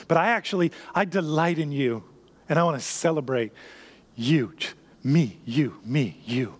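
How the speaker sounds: gentle, intimate and low; God speaking tenderly. Acted. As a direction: speak like a loving father close to his child. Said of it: "But God, your Father, saying".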